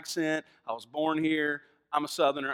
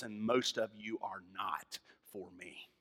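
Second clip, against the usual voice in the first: hushed verdict; 8 semitones lower, 10 dB quieter, slower than usual. Acted.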